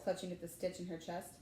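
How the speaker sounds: ordinary delivery, nothing marked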